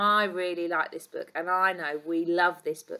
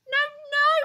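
high, loud storybook hyena; hyena's excited denial; 9 dB louder than usual. Acted. Hyena corrects the keeper, high excited denial.

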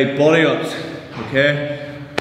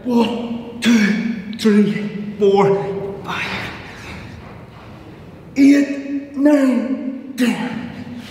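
doing push ups; 6 semitones higher, much slower than usual. Real, not acted. Counting one-leg push-up reps.

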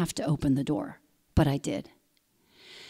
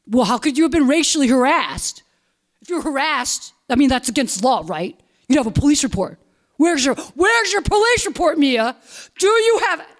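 higher, louder mocking interrogation; retold angry yelling; 7 semitones higher, 4 dB louder than usual. Dad on the condo board demanding her police report, higher and louder. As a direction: badger her with every question. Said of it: "He yelled at me".

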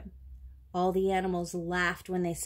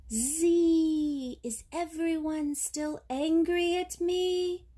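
high, drawn-out buzzing whine; whining mosquito; 9 semitones higher, 4 dB louder, slower than usual. A narrator's reading. Guilty mosquito whines in people's ears, high drawn-out whine. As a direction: stretch it out in a thin insect whine. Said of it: "whining in people's ears".

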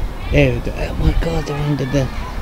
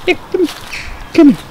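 high coaxing call; faster than usual. Real, not acted. Coaxing squirrel over.

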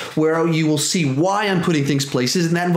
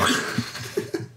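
soft airy chuckle; quiet breathy laugh; 9 dB quieter than usual. Real, not acted. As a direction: laugh under your breath. Laughs at tomato comment.

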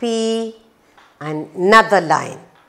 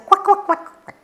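high, quick run of playful quacks; duck quacks; 12 semitones higher, much faster than usual. Pretend. Teacher answering what the ducks say, playful high quacking. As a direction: quack like ducks for small children. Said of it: "what do the ducks say".

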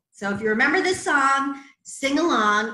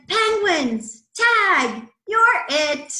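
lightly voiced seal, higher pitched; playful seal; 8 semitones higher, 7 dB louder, much slower than usual. A narrator's reading. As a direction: call out like a child starting a game of tag. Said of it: "silly seal said".

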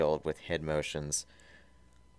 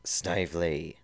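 ordinary delivery

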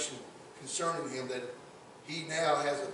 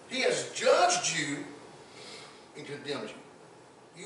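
loud, higher preacher's voice; fiery preaching; 4 semitones higher, 5 dB louder than usual. Acted. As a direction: preach it to the congregation with fire. Preaching at full force.

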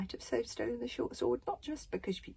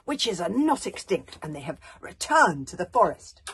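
much louder, hard proclamation; ringing hardness; 15 dB louder than usual. Acted. Queen warning the whole crowd, ringing and loud. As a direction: ring it out like a battle warning. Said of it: "lifted her gentle voice to one of ringing hardness".

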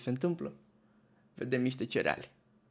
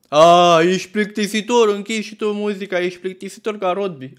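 loud, high heckle; mock taunting shout; 5 semitones higher, 9 dB louder than usual. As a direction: imitate rowdy friends jeering at a killjoy. Partygoers taunt him over the music.

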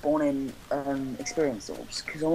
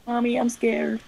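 put-on higher, pleading little-kid whine; mock scared child; 9 semitones higher than usual. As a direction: play a frightened child calling mommy. Stuck up high, pretends child.

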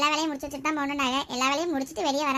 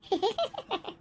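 light, high-pitched giggle; laugh; 5 semitones higher, 5 dB quieter than usual. Acted. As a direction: giggle softly after teasing. Teasing chat between sisters-in-law. (மெல்லிய சிரிப்பு)

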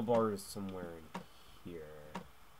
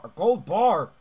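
"A gold bar!" shouted high and loud in delight; excited shout; 7 semitones higher, 14 dB louder, slower than usual. Real, not acted. Gamer spotting treasure in a house, thrilled loud shout. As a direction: yell it out, thrilled by the find.

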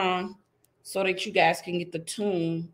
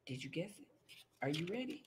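much softer conspiratorial question; hushed suspense; 4 semitones lower, 19 dB quieter, much faster than usual. Acted. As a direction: draw the kids in quietly. Building suspense for kids.

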